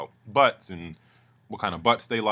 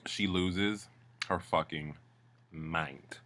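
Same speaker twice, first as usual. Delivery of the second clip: ordinary talk, nothing marked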